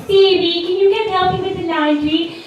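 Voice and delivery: imitating mom. Mom calling daughter for laundry help, high. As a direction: call across the house like mom. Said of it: "she'll be like".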